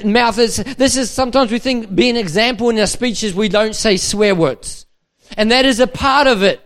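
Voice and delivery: loud, high, driving sermon delivery; full-force preaching. Acted. Pastor exhorting young leaders, preaching loud and high. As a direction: rouse the room, push every line.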